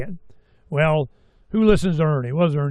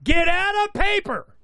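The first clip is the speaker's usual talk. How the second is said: much higher mock dad voice, half-joking; papa's warning; 11 semitones higher than usual. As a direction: play the family patriarch, squeaky and insistent. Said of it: "here comes Papa".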